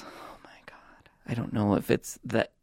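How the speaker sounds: swooning whisper; breathy awed whisper. Real, not acted. Swooning over sweaters.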